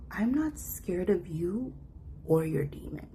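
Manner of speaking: slow steady retort; calm, unshaken; slow calm defiance. Retold or imitated. Facing mom down.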